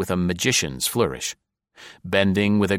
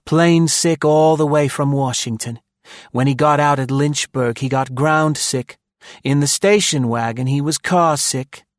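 higher, louder mocking woman; sardonic woman voice; 7 semitones higher, 5 dB louder than usual. A narrator's reading. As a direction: sardonic wife complaining. Maud voiced by narrator.

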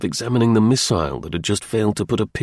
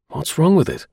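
higher-pitched puzzled demand; 6 semitones higher than usual. A narrator's reading. Terry stopped from touching the dart, demanding. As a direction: ask it pointedly, pitched up. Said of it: "he demanded".

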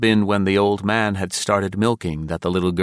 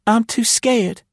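put-on very high, tiny girl voice; scared little girl; 4 dB louder than usual. A narrator's reading. Frightened child to a bound stranger, high and timid. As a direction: squeak it out timidly. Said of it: "she whispered".

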